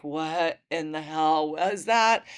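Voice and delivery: slow, drawn-out bewilderment; dazed disbelief. Retold or imitated. Woman recalls a cigarette making the room spin, dazed disbelief. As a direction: stretch it out, mildly dazed retelling.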